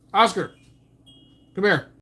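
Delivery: loud, high call to a dog. Real, not acted. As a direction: call the dog across the room. Calling the dog over.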